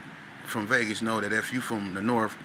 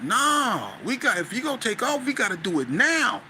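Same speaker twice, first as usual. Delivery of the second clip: high, pushed, insistent call; raised voice that ends in a shout; urgent retold shout; 10 semitones higher than usual. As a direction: hurry them into moving now. Urging friend to strike first.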